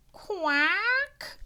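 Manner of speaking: high, put-on duck quack. Duck's voice in story.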